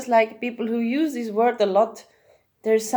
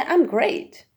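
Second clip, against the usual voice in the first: higher, lifted, upbeat answer; lightening up; 8 semitones higher than usual. Shown as a demonstration. Answering someone who asks how I am, lightened up. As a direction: let the voice lift as you answer. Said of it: "I'm actually lightening up".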